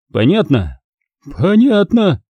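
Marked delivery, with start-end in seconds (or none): 1.4-2.2 high-pitched, submissive woman; hasty agreement. A narrator's reading. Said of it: "поспешно согласилась санитарка".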